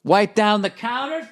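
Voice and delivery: ordinary talk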